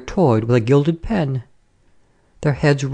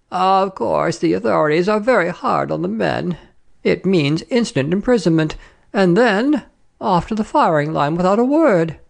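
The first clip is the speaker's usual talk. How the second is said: higher-pitched fussy French madame; officious lady traveller; 5 semitones higher than usual. Acted. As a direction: voice a gossipy lady on a train. Voices the lady opposite.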